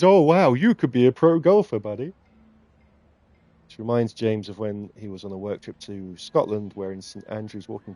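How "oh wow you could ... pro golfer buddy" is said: loud, high mock amazement; over-the-top sarcastic compliment; mocking praise. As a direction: gush with fake admiration, taking the piss. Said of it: "they're just taking the piss".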